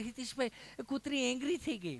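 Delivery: mock an English-educated visitor in a mild fluster. Visitor reports to Hiteshbhai that the dog got angry. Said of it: "English ભણેલું".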